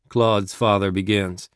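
ordinary delivery, nothing marked

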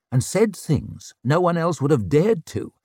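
usual speaking voice

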